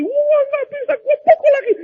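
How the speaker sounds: very high-pitched, hammed-up outrage; mock rampage. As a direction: act out a husband flying into a rage. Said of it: "Ngamuk lagi". (pura-pura mengamuk, suara melengking)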